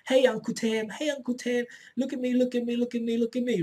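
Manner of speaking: lightly voiced three-year-old calling look at me; nagging kid. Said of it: "keep pulling on your shirt and say".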